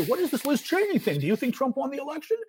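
high-pitched mimicry of eager reporters; imitating reporters' questions. Host voicing reporters who ask about Cheney, high-pitched imitation. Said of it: "Reporters wanna say".

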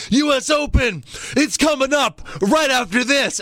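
high announcer voice; Cena announcing. Retold or imitated. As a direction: throw to commercial like an announcer. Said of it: "He's like".

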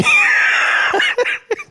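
shrieking high burst of laughter; loud high laugh. Real, not acted. Recalling embarrassing drive-thru moment.

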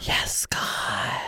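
breathy whisper into the mic. Pretend. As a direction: hush it like a secret. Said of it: "I should do that in my ghost voice".

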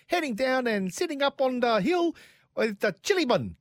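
hammed-up, high-pitched New Zealand accent; put-on high New Zealand voice. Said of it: "New Zealand accent".

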